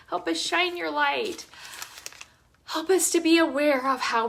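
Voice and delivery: heartfelt prayer, rising then softening; earnest prayer. Real, not acted. Closing the video with a prayer for love, earnest. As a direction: pray it sincerely to the Lord.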